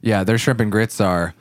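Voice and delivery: ordinary delivery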